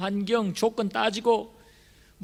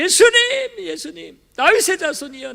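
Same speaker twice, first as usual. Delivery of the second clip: shouting out the name, much higher and louder; a desperate shout for Jesus; loud cry; 7 semitones higher, 9 dB louder than usual. Acted. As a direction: cry out over the crowd. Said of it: "더 크게 소리를 지릅니다".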